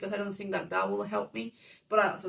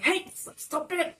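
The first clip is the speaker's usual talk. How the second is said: retold shout; 7 semitones higher, 3 dB louder than usual. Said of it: "I used to shout at her".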